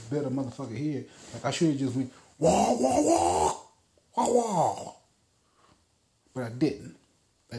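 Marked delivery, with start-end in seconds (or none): 2.4-4.9 loud revving car sound done by mouth; mouth engine noise. Pretend. Wishing he'd revved at the old lady, loud mouth engine roar. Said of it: "made the noise with my mouth".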